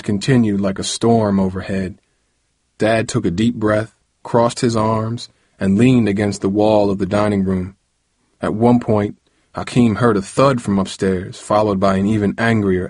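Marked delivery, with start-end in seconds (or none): none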